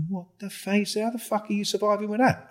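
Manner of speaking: mock gobsmacked, half laughing; incredulous laughing quote. Manchester undercover laughing at their kit, incredulous. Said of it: "he just pissed himself".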